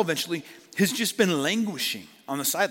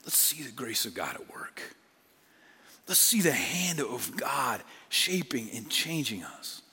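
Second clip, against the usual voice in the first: whisper; 4 semitones lower, 6 dB quieter than usual. Real, not acted. Hushed prayerful plea.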